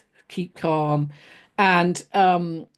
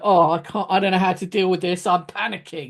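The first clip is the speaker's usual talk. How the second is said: quick, flustered mock panic. Shown as a demonstration. A trainer mimicking a manager who panics at an upset employee. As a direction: gabble it like you're losing control. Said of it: "instead of just, you know".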